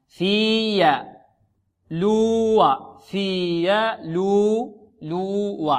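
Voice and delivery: في يا، لو with very long "fii" and "luu"; separated syllables. Shown as a demonstration. Teacher isolating the joins, separated syllables. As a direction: sustain each vowel, then a distinct glide. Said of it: "أظهر".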